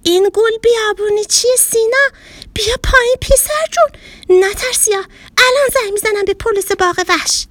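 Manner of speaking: terrified grocer. Acted. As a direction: yelp it out in fright at the monster. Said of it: "با وحشت گفت".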